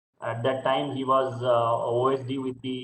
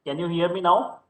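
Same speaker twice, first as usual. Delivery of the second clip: louder, raised pitch; speaking up; 6 semitones higher, 5 dB louder than usual. Real, not acted. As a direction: project so they can hear. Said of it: "if little louder".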